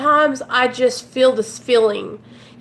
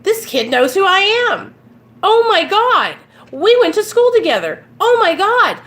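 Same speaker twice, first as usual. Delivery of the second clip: excited; 7 semitones higher, 5 dB louder than usual. Retold or imitated. Retelling an excited recognition.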